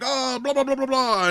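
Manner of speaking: high-pitched blah-blah babble; mock pitchman spiel. Mimicking Mister Major World's Spanish ad, high mock babble. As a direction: mimic an excited foreign-language spiel in nonsense. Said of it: "And he's all like".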